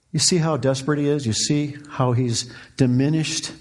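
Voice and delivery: normal talking voice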